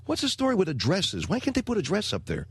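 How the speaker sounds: high, fast griping at his wife; exasperated complaint. Retold or imitated. Husband gripes to wife about missing addresses, high exasperated. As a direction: complain fast and high like you can't believe it. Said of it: "I said".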